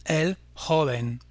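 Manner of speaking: el joven, slowly, stressed on JO; slow model of el JO-ven; stress on second-to-last syllable. Shown as a demonstration. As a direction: lean on "jo" in joven, taking it slowly. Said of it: "l'avant-dernière syllabe et on dira".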